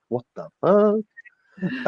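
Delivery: mock bewilderment. Stranger on first hearing the speaker talk, mock bewildered. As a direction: imitate a stranger thrown by the voice. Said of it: "then I started talking and".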